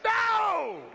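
sudden high loud shouted no; parent's shout. Retold or imitated. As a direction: bark a parent's flat refusal. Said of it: "a little inflection in the voice".

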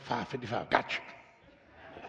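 soft, unvoiced muttered aside; quiet aside. Grandfather hearing the money, murmured softly. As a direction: slip it out under your breath.

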